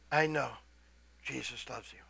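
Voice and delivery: very quiet, tender words; soft reassurance. Retold or imitated. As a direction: tell them kindly, barely above a murmur. Example of a kind word.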